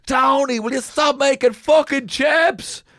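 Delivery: high, loud mock scold of the chip boy; imitating angry boss. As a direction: shriek it like an exasperated manager. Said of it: "And she's like".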